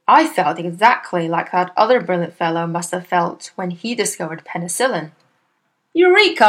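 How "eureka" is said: high, loud triumphant cry; cried out. A narrator's reading. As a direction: shout it like a sudden discovery. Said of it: "I cried".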